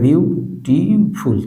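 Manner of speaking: Beautiful slowly split as বিউ, টি, ফুল; syllable by syllable. Shown as a demonstration. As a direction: break beautiful into three separate beats, বিউ-টি-ফুল. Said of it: "তিন syllable বিশিষ্ট". (Beautiful তিন ভাগে ভেঙে ধীরে বলা: বিউ, টি, ফুল)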